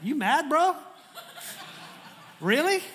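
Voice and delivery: high, loud laughing "You mad, bro?"; a mock street taunt, laughing; slangy teasing God. Preacher voicing God teasing Jonah, laughing and high. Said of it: "Let me translate that".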